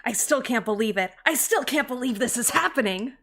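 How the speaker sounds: earnest young hero, lightly voiced; anime protagonist voice. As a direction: read it like an anime lead. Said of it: "I'm gonna use my best confidence".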